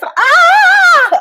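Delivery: high short mock scream; mock panic scream. Acting out riding with a fast driver, high mock panic scream. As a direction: yelp like a scared passenger.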